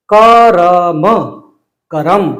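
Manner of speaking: करम with a full a after र; slow teaching demo of करम; kaa-ram said in full. Shown as a demonstration. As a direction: say करम as two clear syllables, ka-ram. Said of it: "अब हम इसी से मिलता जुलता".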